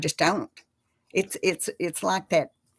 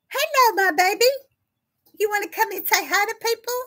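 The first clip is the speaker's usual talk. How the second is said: cat voice; 13 semitones higher, faster than usual. Real, not acted. Calling her cat over in a very high sing-song voice. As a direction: coo up high like calling a kitten. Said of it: "There's that cat voice".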